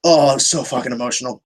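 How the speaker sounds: ordinary talk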